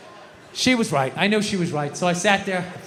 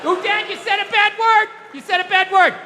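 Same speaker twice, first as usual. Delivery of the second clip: put-on higher, sing-song tattling little girl; child voice tattling; 10 semitones higher than usual. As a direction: play a kid catching dad swearing. Daughter quoted scolding.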